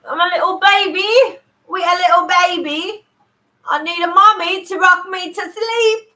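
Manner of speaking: high, loud put-on baby voice; hammed-up babyish wail; little baby act. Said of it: "I don't know what accent I'm doing".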